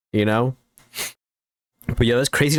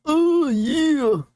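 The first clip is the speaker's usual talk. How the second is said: very high squeaky yeah. Acted. High pitched meme voice.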